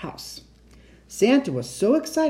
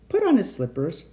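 ordinary delivery, nothing marked

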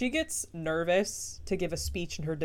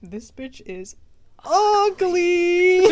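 rising loud mocking jab; snooty insult; 12 semitones higher, 11 dB louder, much slower than usual. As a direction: hit ugly high and loud. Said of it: "the grandma's like".

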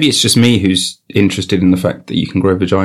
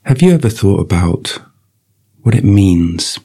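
slow, drawn-out ad delivery; mock sponsor read; slower than usual. Reading the episode's sponsor message, slow mock ad voice. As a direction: stretch out each phrase like a hammy advert.

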